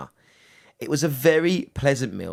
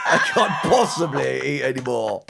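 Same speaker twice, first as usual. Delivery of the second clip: mock Steve; 3 semitones higher, 3 dB louder than usual. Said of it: "he had shot his load".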